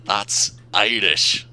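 hammed-up Scottish twins correcting him, drawn out; mock Scottish accent. Scottish engines mocking sixteen back.

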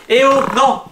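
high, loud startled no; shocked cry. Acted. Shocked at cousin becoming Piggy.

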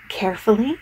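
usual speaking voice

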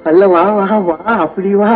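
teasing echo. Acted. Echoes her word teasingly.

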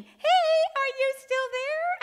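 put-on very high, loud kid calling out; high-pitched little-kid call; little child's voice. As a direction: pipe up loudly like a small child checking through the wall. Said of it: "the voice keeps saying".

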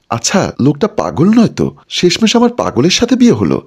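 ordinary delivery, nothing marked

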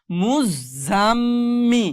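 জান্না with the ন্ন hummed long through the nose; held nasal ghunnah. Shown as a demonstration. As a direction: stretch the nasal hum in জান্না. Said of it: "গুনগুন আওয়াজ".

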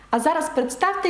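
usual speaking voice

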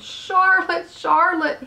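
high, anguished moan. A narrator's reading. Wilbur grieves for his dying friend, moaning high. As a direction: wail her name in grief. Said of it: "he moaned".

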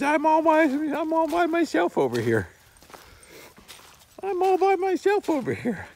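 very high whiny dog voice; voicing the lonely dog. Dog left all alone by the RV, very high whine. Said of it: "He says".

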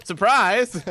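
spring it on her playfully; mock surprise. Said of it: "being like".

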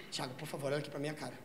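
low, soft mock-serious plea; hushed pleading. Stoned partner demands Thiago's attention, acted hushed plea. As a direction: imitate an earnest, hushed appeal.

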